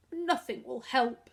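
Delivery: put-on sobbing boy to his brother; wretched. A narrator's reading. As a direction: sound utterly defeated. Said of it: "in a totally wretched voice".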